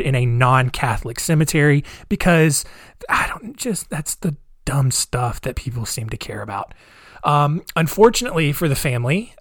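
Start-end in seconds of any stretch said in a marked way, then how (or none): 3.1-6.7 whispered aside. Real, not acted. Scornful aside to listeners.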